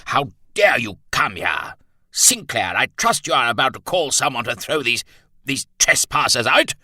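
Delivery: loud, high, blustering colonel; Bennett exploding. A narrator's reading. Colonel ordering the trespassers thrown out, exploding with indignation. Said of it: "Bennett exploded".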